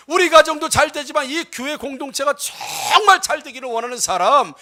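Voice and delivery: forceful sermon with punched key words; emphatic pulpit exhortation; impassioned preaching. Real, not acted. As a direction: preach it out with drive, stretching key phrases. Preaches to the congregation.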